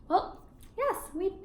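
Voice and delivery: ordinary delivery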